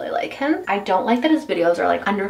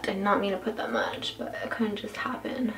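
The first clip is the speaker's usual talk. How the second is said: muttering; 10 dB quieter than usual. Real, not acted. Quiet after the mistake.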